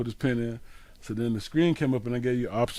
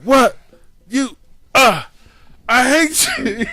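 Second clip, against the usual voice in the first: high, loud mock tantrum, halting then stretched out; imitated angry screaming; 12 semitones higher, 9 dB louder, much slower than usual. Son imitating dad raging at the ATM, angry screaming. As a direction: scream it in fits like dad losing his temper. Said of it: "He starts screaming at the A T M".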